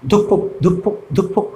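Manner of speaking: ধুকফুক in short, even pulses; each ধুকফুক a separate two-beat thump; heartbeat rhythm. Shown as a demonstration. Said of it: "বুকে হাত দিয়ে দেখবে".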